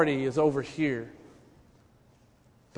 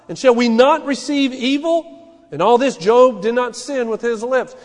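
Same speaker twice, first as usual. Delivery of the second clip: loud, higher, driving pulpit voice; full-force preaching; 7 semitones higher, 8 dB louder than usual. Acted. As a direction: preach it out to the congregation. Preaching and reading Job aloud.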